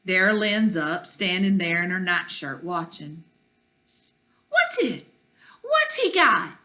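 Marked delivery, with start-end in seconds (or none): 4.5-6.6 put-on very high, insistent little-kid voice; pestering child. A narrator's reading. Little sister pestering Ma at the door, high nagging. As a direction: pipe up high, nagging for an answer. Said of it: "pestering Ma's arm".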